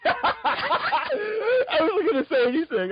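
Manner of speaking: laughing hard while talking; words squeezed out through laughter. Real, not acted.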